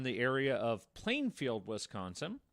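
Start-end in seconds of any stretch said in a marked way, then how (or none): none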